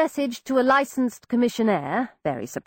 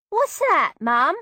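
put-on Cockney girl; 7 semitones higher, slower than usual. Peggy answers in Cockney.

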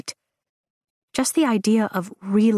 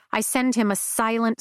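plain reading voice

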